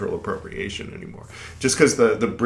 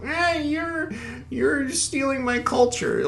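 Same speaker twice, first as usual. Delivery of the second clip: much higher, slow whiny mimicry; mocking whine; slower than usual. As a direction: imitate a complaining crybaby. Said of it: "whine about".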